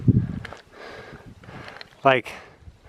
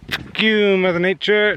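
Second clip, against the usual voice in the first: mock angry yell; 9 semitones higher, 4 dB louder than usual. Mock cursing at the sun.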